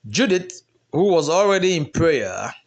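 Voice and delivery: ordinary delivery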